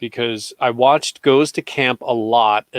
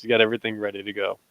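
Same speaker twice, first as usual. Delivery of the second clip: ordinary talk, nothing marked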